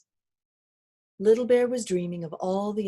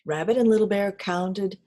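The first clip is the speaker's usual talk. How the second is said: usual speaking voice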